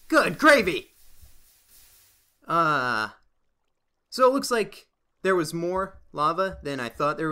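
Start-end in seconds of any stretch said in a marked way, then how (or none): 0.1-0.9 "Good gravy!" blurted loud and high in shock; good gravy exclaimed. Real, not acted. Player stunned by a lava lake, loud surprised exclaim.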